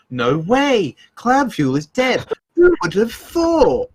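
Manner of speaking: high, slow, mock-shocked sarcasm; fake surprise. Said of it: "You did the epitome of false sarcasm".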